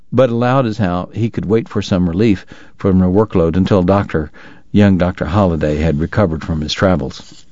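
ordinary talk, nothing marked

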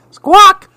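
high, loud parrot squawk. Acted. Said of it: "said Polly".

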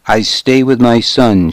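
ordinary delivery, nothing marked

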